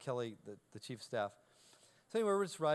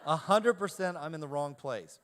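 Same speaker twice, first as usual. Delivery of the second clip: laughing; 2 semitones higher, 8 dB louder than usual. Retold or imitated. Fearing arrest, laughing at himself.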